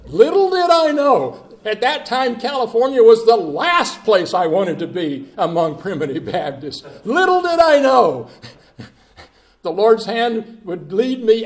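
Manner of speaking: high, loud, ringing refrain; full-force preaching. Acted. Preacher to congregation, ringing revival preaching. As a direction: hammer the refrain home.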